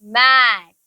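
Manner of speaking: मैट drawn out with a long vowel and falling tone; pronunciation demo. Shown as a demonstration. Teacher shows the class how to say मैट, pronunciation demo. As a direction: stretch the vowel of मैट, clearly. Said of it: "कैसे?".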